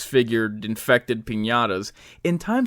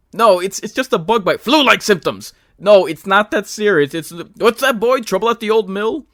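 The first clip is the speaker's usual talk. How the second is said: higher, louder, pleading excuses; protesting patient voice; 7 semitones higher, 6 dB louder than usual. Pretend. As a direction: insist it's nothing, louder.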